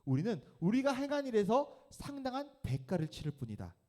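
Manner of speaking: high, loud scolding; stern rebuke. Acted. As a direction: rebuke the other robber sharply. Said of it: "꾸짖어서 이르되". (동료 강도를 꾸짖는 강도, 높고 큰 호통)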